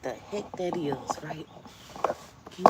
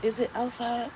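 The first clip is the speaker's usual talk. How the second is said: normal talking voice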